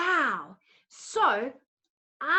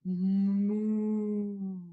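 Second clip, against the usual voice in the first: low, slow drawn-out moo; a long low cow moo; 8 semitones lower, 4 dB quieter than usual. Shown as a demonstration. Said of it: "Can you go moo?".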